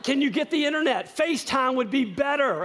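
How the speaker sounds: pestering with giddy requests; eager demand. Retold or imitated. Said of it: "You know".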